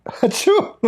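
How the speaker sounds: high squeaky sneeze broken by laughter; laughing fake sneeze. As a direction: fake a sneeze while cracking up. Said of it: "Can you please sneeze?".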